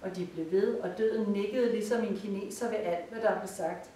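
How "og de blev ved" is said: usual speaking voice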